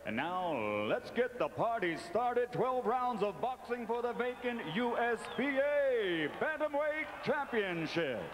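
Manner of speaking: hyped announcer. Acted. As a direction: pump up the crowd. Hyping the start of the show.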